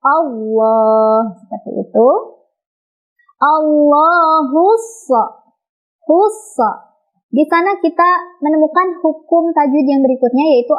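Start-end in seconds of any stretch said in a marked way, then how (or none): 0.0-1.3 long "Allah" with a heavy, dark L; thick-L Allah. Shown as a demonstration. Teacher repeats the correct reading, thick "Allah". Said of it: "cara bacanya adalah ditebalkan". 3.4-6.6 slow drawn-out "Allahus", "Hus" running straight into the S; silent article. Shown as a demonstration. Teacher models joining to sod, slow chanted "Allahus". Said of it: "alif lamnya tidak dibaca".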